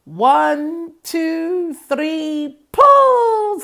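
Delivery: rising count ending in a high, loud pull; rally the whole line for a heave; farmer's count to pull. Acted. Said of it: "said the farmer".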